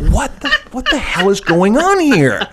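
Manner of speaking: high, laughing mock outrage; baffled outrage. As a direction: wail it in comic disbelief. Retells baffled outrage at fake accent.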